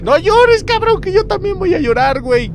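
high, whiny plea on the edge of tears; distressed pleading. Real, not acted. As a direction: beg in a high panicky voice. Begs crying figure to stop. (súplica aguda y angustiada)

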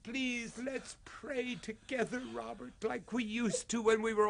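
high, broken begging; tearful pleading. Acted. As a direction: plead with him through tears. Father pleading with son.

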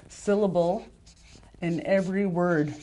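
ordinary talk, nothing marked

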